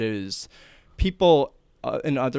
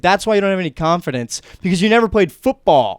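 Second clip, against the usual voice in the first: loud, higher-pitched berating; retold angry lecture, building in volume; mock screaming; 5 semitones higher, 8 dB louder than usual. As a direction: imitate a sports guy yelling at a kid. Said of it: "he just started screaming at me".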